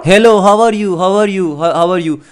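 loud, halting broken English; foreign accent. Acted. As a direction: put on a thick foreign accent. Prankster's loud broken-English greeting.

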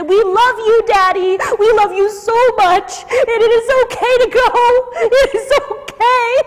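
high, loud, slow voice breaking with emotion; tearful farewell. Retold or imitated. Family telling dying father it's okay to go, tearful. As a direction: pour out love while letting go.

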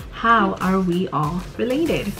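ordinary talk

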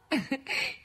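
kurzes, hohes Lachen; kurzes Kichern. Acted. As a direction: kichert amüsiert. Lacht über Sal. (belustigtes Kichern über Sal)

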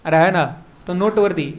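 ordinary talk, nothing marked

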